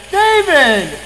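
sudden loud, high yell imitating a recording; yelled name clip. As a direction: blast the name out like a recorded shout. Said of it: "they play the clip of his voice".

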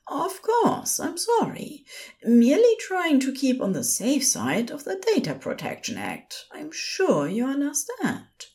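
high, composed nurse voice; even-tempered, high-pitched professional; level tone. A narrator's reading. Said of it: "Aziraphale kept his own tone level".

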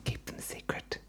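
hushed secretive whisper; spooky whisper. Pretend. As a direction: breathe it like a conspiracy.

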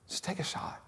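breathy whisper, no voice; whispered urging. Acted. Preacher whispering to the church to take a shot. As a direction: whisper it like a secret plea. Whispered plea after quote.

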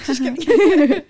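loud laugh through the words. Real, not acted. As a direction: laugh it out, slowly. Laughs off her sarcastic remark.

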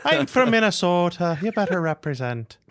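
jokey Irish-tinged Minnesotan brogue; Irish Minnesotan accent. Acted. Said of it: "Irish Minnesotan".

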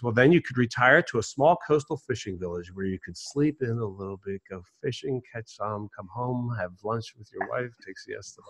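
fading from normal to very soft; dawning realization. Acted. Businessman describes retirement to fisherman, fading away sheepishly. Punchline trails off as realization dawns.

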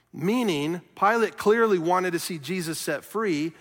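ordinary talk

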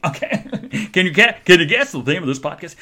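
laughing. Real, not acted. Laughs at own bathroom remark.

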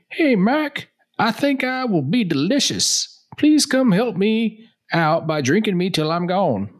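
put-on high, slow old soldier drawl; a beer calling out like an old soldier. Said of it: "soldier voice or something".

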